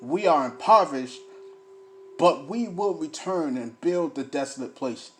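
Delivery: loud, emphatic scripture reading; booming pulpit delivery; fiery preaching. Acted. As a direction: preach it to the congregation. Reads scripture, preaching loudly.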